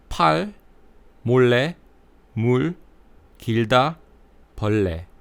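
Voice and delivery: 팔 몰래 물 길다 벌레 with a light Korean L; tongue tip lightly on the ridge for each ㄹ; Korean L sounds. Shown as a demonstration. Teacher modelling Korean ㄹ words, clear and careful. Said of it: "And for L sounds, we have".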